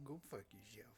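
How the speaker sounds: whisper. Real, not acted. Muttered aside after cash for gold.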